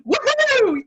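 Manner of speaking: loud, high, hammed-up enthusiasm; over-the-top hype. Shown as a demonstration. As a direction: burst in like a hyped-up host. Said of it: "not the time for me to go in and say".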